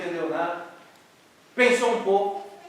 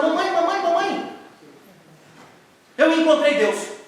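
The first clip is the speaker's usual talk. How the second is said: put-on high, loud childish calls; a thrilled kid bursting in with news; excited child calling; 8 semitones higher, 7 dB louder than usual. Boy calling his mother, excited and high.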